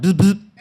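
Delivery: loud, high imitated clipper buzz; buzzing clipper imitation. Barber giving a quick buzz cut, clipper noise. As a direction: buzz twice like electric hair clippers.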